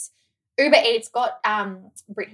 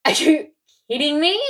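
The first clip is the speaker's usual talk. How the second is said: higher, louder mock disbelief; retold exasperation; 6 semitones higher, 6 dB louder than usual. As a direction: act out being fed up, voice raised. Retold disbelief at the wrong drink.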